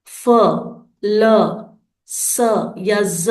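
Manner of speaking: each sound "फ", "ल", "स", "ज" said alone with pauses; teacher modelling "फ, ल, स, ज" one by one. Shown as a demonstration. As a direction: isolate each sound, pausing between. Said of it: "अंत में हमें ... की ध्वनि सुनाई दे".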